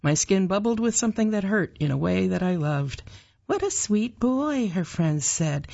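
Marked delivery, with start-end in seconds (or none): 3.5-4.7 lightly voiced, higher-pitched gushing over a child; cooing admirer. A narrator's reading. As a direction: coo a little, pitched up, like mom's friend. Mother's friends praising a child.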